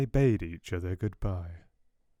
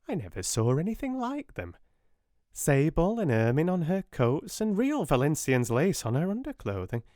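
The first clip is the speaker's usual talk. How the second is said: high, flustered spinster voice; timid sister reporting, high and fluttery; fussy Miss Amelia; 7 semitones higher than usual. Acted. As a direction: sound a bit alarmed and fussy.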